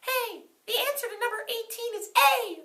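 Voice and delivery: a high, strange whisper of a hint; very high mystery voice. Acted. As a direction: pitch it way up like a sudden voice in your head. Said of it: "I heard a voice say".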